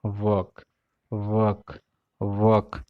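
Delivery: वर्क as "wahk", no r; walk demonstration. Shown as a demonstration. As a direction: say वर्क like walk, open vowel. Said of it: "W A L के".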